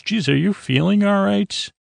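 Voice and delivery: high-pitched mock concern; hammed-up worried question. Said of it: "Data's very concerned".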